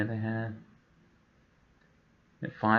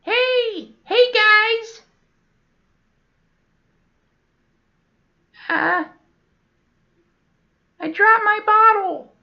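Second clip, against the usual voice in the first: put-on very high, louder squeaky old-baby voice; 8 dB louder, much slower than usual. Old guy calling down to the kids, very high loud squeak. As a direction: call down like a squealing toddler. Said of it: "this old guy is like".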